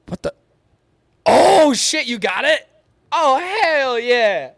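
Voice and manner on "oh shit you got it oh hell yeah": excited. Real, not acted. Sees comic arrived in mail.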